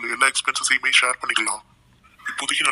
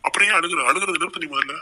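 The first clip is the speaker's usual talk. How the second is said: raised, higher pitched scold at her tears; exasperated scolding; 4 semitones higher than usual. Acted. Cousin scolds crying Tamil, exasperated raised voice. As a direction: demand she stop crying, voice up.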